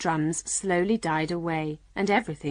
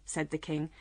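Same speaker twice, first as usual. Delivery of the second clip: usual speaking voice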